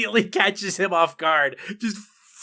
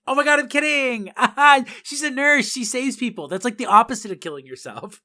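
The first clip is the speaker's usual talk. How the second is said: high, loud manic cheer; giddy laughing backpedal; 6 semitones higher, 5 dB louder than usual. Retold or imitated. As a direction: laugh it off brightly. Character taking back a joke.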